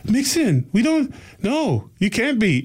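high-pitched imitation of a Scottish woman; Scottish accent. Scottish woman refusing to believe he is half Scottish, high imitated accent. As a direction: imitate her Scottish disbelief, pitched well up. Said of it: "she had a really strong Scottish accent".

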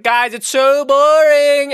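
high, slow, whining complaint; whiny bored O'Brien. Retold or imitated. O'Brien whining to the crew that he is so bored. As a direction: drag it out like a bored kid. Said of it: "he's like".

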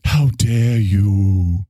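very slow, stretched mock indignation; hammed-up scolding line; Greta Thunberg voice. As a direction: draw out every word in parody. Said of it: "you don't like Greta Thunberg".

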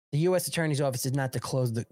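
normal talking voice

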